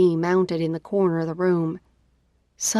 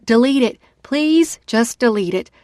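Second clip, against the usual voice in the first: desperate loud begging; loud pleading; 5 semitones higher, 6 dB louder than usual. Acted. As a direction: plead loudly, close to tears. Drunk begging to delete message.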